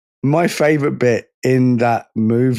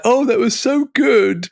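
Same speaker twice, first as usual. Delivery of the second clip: high-pitched imitation of a gushing host; squeaky mock enthusiasm; gushing mock praise; 11 semitones higher than usual. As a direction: parody an over-impressed co-host, voice way up. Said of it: "the other host is like".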